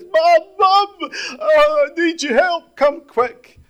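high, loud, desperate cry for Mum; panicked call. Retold or imitated. Daughter calling her mum for help, panicked and loud. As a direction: shriek for help like the kitchen's on fire. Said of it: "She says".

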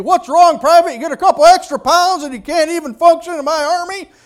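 high, loud barking drill sergeant; drill sergeant yelling. Retold or imitated. Drill sergeant yelling at a struggling recruit, high and loud. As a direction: bellow it down at the struggling private. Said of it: "they're yelling at us".

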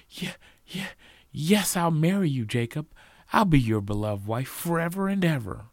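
high, eager bride-to-be; stammer, then gush loudly; excited acceptance. A narrator's reading. Sally accepts Jacob's marriage proposal, excitedly.

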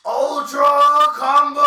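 high, very loud drawn-out shout; booming video game announcer impression; imitated game-announcer yell. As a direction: bellow it like a fighting-game combo call. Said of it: "they would fucking yell".

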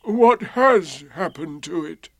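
mournful, worried question; Eeyore. A narrator's reading. Eeyore asking about his tail, gloomy. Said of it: "said Eeyore".

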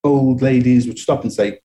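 ordinary delivery

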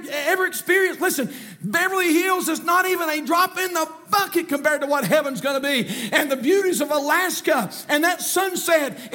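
high, impassioned pulpit shout; fervent preaching. Real, not acted. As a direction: preach it at full force to the congregation. Preaching at full force.

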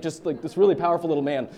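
ordinary talk, nothing marked

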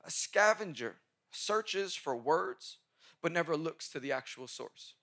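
slow, spaced dictation pace; each word laid out for note-taking. Shown as a demonstration. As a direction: read it slowly so they can write it down. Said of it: "please write this down".

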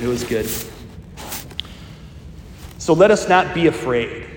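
usual speaking voice

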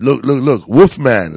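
calling out a nickname loudly, teasing. Retold or imitated. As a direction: shout it out across the street to get a laugh. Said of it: "Ike was doing that loud".

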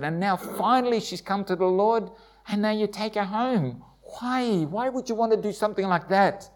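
a cry of confusion thrown up to God; anguished why. Retold or imitated. Husband at the morgue, asking God why, high and anguished.

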